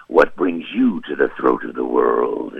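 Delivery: low, slow ancient dragon; Paarthurnax dragon voice. Pretend. Greeting a traveller as Paarthurnax, low and slow. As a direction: speak it like an old wise dragon. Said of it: "Paarthurnax was a great character".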